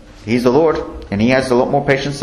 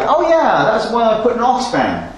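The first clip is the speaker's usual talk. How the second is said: higher, dismissive sneer; mocking scoffer; 6 semitones higher than usual. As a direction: brush off the new jacket with scorn. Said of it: "they'll mock you".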